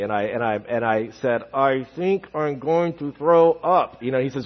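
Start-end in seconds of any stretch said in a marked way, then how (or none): 1.5-4.0 queasy. Retold or imitated. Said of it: "starting to kinda get queasy".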